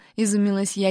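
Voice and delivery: plain reading voice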